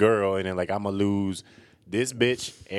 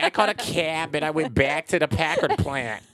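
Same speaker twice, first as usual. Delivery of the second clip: high, loud exaggerated Michigan vowels; mock Michigan accent; 6 semitones higher, 4 dB louder than usual. Mimicking how she talks every day, Michigan accent. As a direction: tease with a blown-up Michigan accent. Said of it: "you be like".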